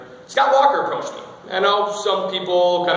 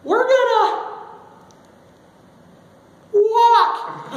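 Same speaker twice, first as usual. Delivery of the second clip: put-on very high squeaky kid on TV, halting; embarrassing younger self; 4 dB louder, much slower than usual. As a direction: squeal it, with a long pause before the last word. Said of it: "one of the more embarrassing moments".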